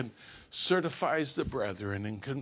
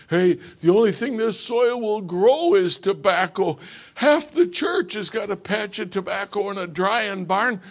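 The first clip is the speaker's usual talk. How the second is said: louder, higher hammed-up deacon laying down the law; imitating a blunt deacon; 4 semitones higher, 7 dB louder than usual. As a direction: play the pushy local deacon, half-joking. Head deacon scolding the new preacher.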